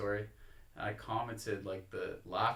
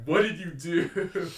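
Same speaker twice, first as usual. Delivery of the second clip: higher, louder and slow, an alarmed mother demanding answers; worried mom; 8 semitones higher, 9 dB louder, slower than usual. Retold or imitated. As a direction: grill your son like a panicked mom. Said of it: "she was like".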